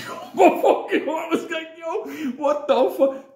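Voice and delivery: high-pitched laughing panic; panicked reaction. Retold or imitated. Retold shock at the screaming.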